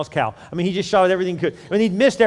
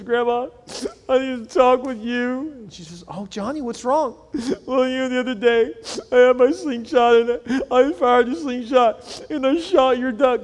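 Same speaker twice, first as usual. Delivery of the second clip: put-on guilty little boy; 8 semitones higher than usual. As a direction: confess like a sorry child.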